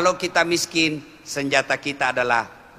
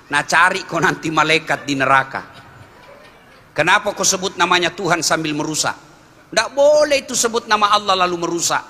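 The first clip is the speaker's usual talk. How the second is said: ordinary talk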